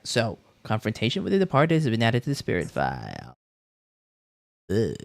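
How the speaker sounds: usual speaking voice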